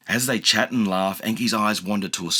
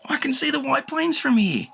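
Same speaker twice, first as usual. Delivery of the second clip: high thankful voice; relieved and glad; grateful. A narrator's reading. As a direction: kid seeing home. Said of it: "says Enki gratefully".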